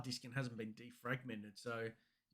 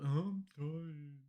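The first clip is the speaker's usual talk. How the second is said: mock child whine; 5 semitones higher, 5 dB louder, slower than usual. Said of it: "I'm like".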